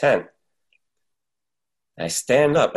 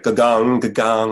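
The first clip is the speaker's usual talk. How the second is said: loud bell imitation; 3 semitones higher, 5 dB louder than usual. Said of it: "I hear, like".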